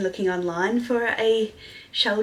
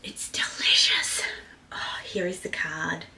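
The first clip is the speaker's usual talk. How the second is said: soft delighted whisper; hushed whisper; 5 semitones lower, 3 dB quieter than usual. Real, not acted. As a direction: whisper with relish. Savouring the bag.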